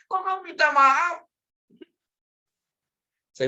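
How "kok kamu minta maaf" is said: high, loud mock disbelief; protesting father. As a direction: imitate an indignant father questioning his son. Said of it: "Orang tua saya protes". (tiruan ayah heran tak terima, tinggi dan keras)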